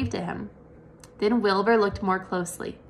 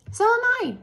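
very high little voice; tiny voice; faster than usual. A narrator's reading. A second daughter answers Wilbur, very high and tiny. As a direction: pipe up brightly. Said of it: "another tiny voice".

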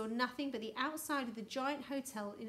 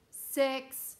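loud, raised, official count; booming countdown; 4 semitones higher, 9 dB louder than usual. A narrator's reading. Houston voice counts down to the freeze, booming. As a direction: call the number out like a loudspeaker. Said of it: "said the voice".

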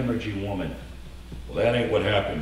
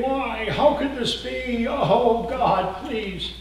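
mock wailing mourner; 12 semitones higher, much slower than usual.

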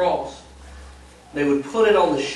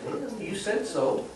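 quiet Jesus reply; 3 semitones higher, 10 dB quieter than usual. Acted. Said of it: "And Jesus says".

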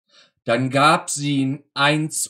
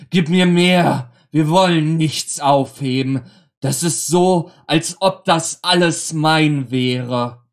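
laut und höher, „Gib mir mehr“ verlangend; gierig; 4 semitones higher, 7 dB louder than usual. Acted. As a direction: fordere das Essen gierig ein. Vater will gierig mehr Essen. (Vater verlangt gierig immer mehr vom Essen)

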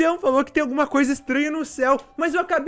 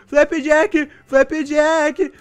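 1 calling out; 5 semitones higher, 6 dB louder, much slower than usual. Real, not acted. Calling her pet urgently.

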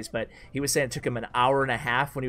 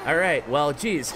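ordinary talk

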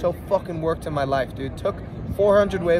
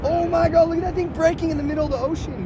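high, fast awestruck shout; excited; 11 semitones higher than usual. Real, not acted. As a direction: blurt it out in awe. Watching the giant wave break.

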